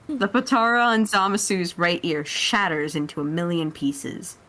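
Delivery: ordinary delivery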